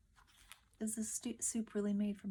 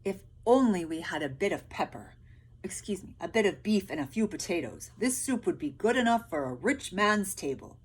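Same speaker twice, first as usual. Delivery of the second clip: louder, tempting soldier; scheming soldier; 7 dB louder than usual. A narrator's reading. Soldiers tempting peasants with a rich man's soup. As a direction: dangle the idea temptingly. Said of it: "the peasants thought".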